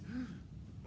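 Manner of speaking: soft, high little gasp; hushed intake of breath; shocked gasp. Acted. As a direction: quietly gasp in dismay. Imagining the shocked witches.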